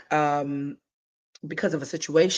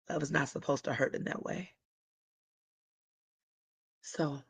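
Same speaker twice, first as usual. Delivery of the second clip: ordinary talk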